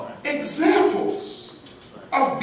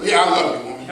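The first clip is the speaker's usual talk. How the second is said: flat, lower, dismissive "Yeah, I love you"; insincere; 9 semitones lower, much faster than usual. Shown as a demonstration. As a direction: toss off "I love you" like it means nothing. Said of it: "Was that sincere?".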